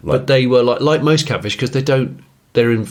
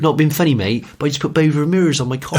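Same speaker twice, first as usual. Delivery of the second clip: put-on West Country accent; 5 semitones higher, faster than usual.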